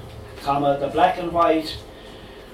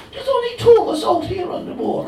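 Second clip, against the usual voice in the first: even Cork telling; Cork accent, steady; 5 dB louder than usual. Pretend. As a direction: lay it out in Cork brogue. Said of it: "delivered in a dodgy Cork accent".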